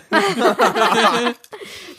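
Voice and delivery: laughing burst up high. Real, not acted. Laughs at the joke.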